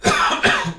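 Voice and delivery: sputtering cough after choking. Real, not acted. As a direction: cough out the wrong swallow. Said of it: "ich hab mich grad verschluckt".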